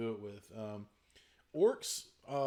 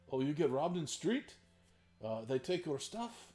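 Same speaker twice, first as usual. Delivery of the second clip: put-on much higher Russian accent, shrugging it off; higher Russian accent on the orc voice; 6 semitones higher than usual. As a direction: play the orc as a deadpan Russian. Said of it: "orcs with a Russian accent".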